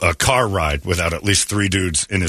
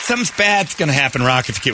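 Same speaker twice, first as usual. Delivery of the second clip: anxious high warning; worried friend; 7 semitones higher, faster than usual. Retold or imitated. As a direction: fret and plead with him. Warning friend voiced in story.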